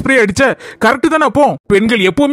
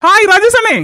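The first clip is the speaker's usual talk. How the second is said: calling out; 9 semitones higher, 3 dB louder than usual. Acted. Calling out to Rajesh outside.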